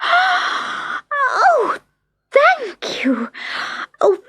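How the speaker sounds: high, gasping thanks, settling into happy chatter; overjoyed little girl. A narrator's reading. Little girl seeing her doll mended, overjoyed. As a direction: gasp with delight and gush.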